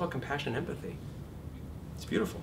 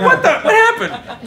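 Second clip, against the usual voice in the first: higher, louder, slow confused laugh; mock bewildered; 17 dB louder, slower than usual. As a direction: play the baffled student, laughing. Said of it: "You're like".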